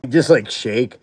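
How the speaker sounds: normal talking voice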